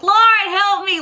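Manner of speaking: high pleading cry to God; desperate plea. Retold or imitated. Girl lying hit in the road, pleading to God. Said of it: "I'm calling on God".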